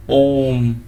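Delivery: ओम held very long and slow; drawn-out om. Shown as a demonstration. Teacher demonstrating the prolonged vowel to students, very slow and held. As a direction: pull ओम out as long as the breath allows. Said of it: "तो लंबा समय खींच रहा है".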